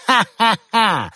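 high, slow, gloating villain laugh. A narrator's reading. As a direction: cackle with malicious glee over the phone. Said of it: "злорадно раздалось в трубке".

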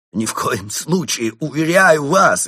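frantic assurance, stretched out at the end; desperate insistence. A narrator's reading. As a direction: protest with all your might. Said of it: "изо всех сил подумал Крот".

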